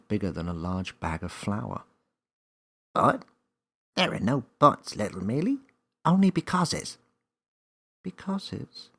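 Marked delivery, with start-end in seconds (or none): 2.9-7.0 high, louder playful elf; cheeky elf. A narrator's reading. 8.0-8.9 puzzled question. A narrator's reading. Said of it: "Millie questioned".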